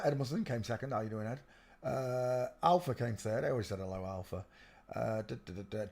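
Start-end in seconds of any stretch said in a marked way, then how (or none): none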